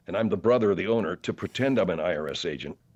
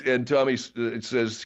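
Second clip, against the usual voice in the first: ordinary talk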